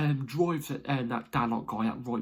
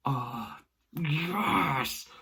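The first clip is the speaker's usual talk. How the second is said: excited; 4 semitones higher than usual. Retold or imitated. Reliving his celebration.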